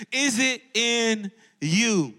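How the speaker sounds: very slow, drawn-out "Is it in you?" with a comic punch; Kevin Hart style. Shown as a demonstration. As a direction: stretch it like a stand-up bit. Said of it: "words of Kevin Hart".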